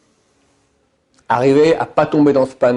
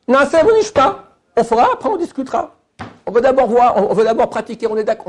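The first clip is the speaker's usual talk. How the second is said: eager Israelites; 8 semitones higher than usual. Retold or imitated. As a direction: pitch it up as the keen people at Sinai. Voicing the Israelites' reply at Sinai. (les Bnei Israël joyeux, voix plus aiguë)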